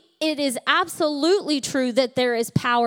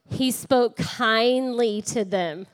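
slow repeat line; slower than usual. Shown as a demonstration. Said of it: "Turn to your other neighbor and say".

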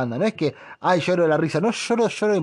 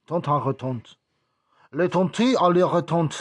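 mock French; 3 semitones higher, 3 dB louder than usual. Shown as a demonstration. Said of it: "como le dicen en Marsella".

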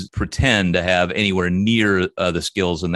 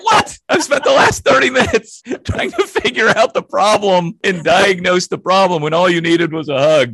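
much higher and louder, an incredulous outburst; incredulous what; 11 semitones higher, 6 dB louder than usual. Retold or imitated. Boss retelling his reaction to the hug, comic high disbelief. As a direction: play your own disbelief big and comic. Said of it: "And I was like".

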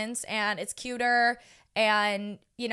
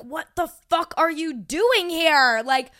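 loud, high incredulous demand; retold flabbergasted outburst; caught off guard; 5 semitones higher, 7 dB louder than usual. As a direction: act out stunned disbelief, stretched then rushed. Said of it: "I was so caught off guard".

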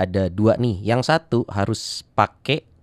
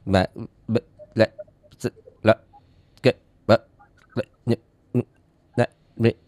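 glitching audio. Pretend. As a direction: fake signal dropouts, syllables only. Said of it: "Putus-putus?".

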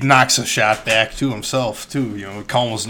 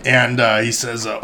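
ordinary talk, nothing marked

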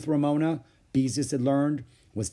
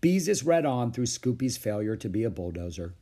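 ordinary delivery, nothing marked

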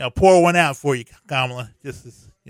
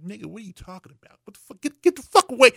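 mock Queen; 5 semitones higher, much faster than usual. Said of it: "the Queen was just standing there like".

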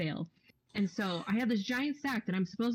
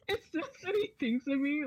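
laughing through the words, higher; 8 semitones higher than usual. Real, not acted. Retelling her boss's offer, laughing in disbelief. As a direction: giggle it out in disbelief.

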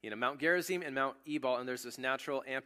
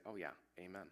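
casual crowd agreement; 5 semitones lower, 12 dB quieter than usual. Acted. Crowd voiced answering a curse.

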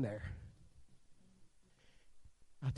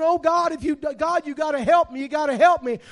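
begging prayer; 12 semitones higher, 14 dB louder than usual. Retold or imitated. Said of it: "You begin to beg, saying".

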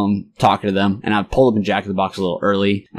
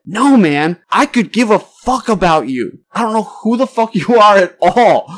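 high, drawn-out mock tirade; exasperated dismissal; 10 semitones higher, 4 dB louder, slower than usual. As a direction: retell the brush-off with rising, stretched exasperation. Retells telling stranger off.

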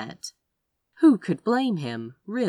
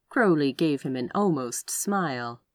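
ordinary delivery, nothing marked

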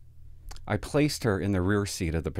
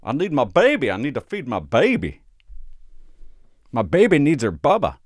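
hammed-up drunk mother, much higher and louder; drunk mom; 5 semitones higher, 7 dB louder than usual. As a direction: wail like a sloppy drunk mother.

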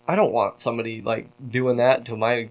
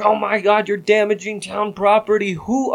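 higher-pitched mock outrage; woman going off; 8 semitones higher than usual. Woman accusing him of damaging town property, mock indignation. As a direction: imitate her scandalized scolding, pitched up. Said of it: "She goes off on me".

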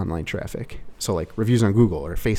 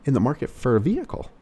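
car salesman question; 6 semitones higher than usual. Shown as a demonstration. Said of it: "question for open car sales".

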